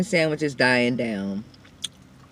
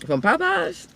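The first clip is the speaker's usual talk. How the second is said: surprised; 11 semitones higher, 5 dB quieter than usual. Real, not acted. Incredulous question.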